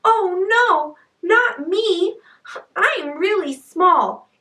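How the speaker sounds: high, pleading little goat voice; scared little goat. A narrator's reading. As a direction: squeal in storybook fright, begging to be spared. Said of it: "scared, said".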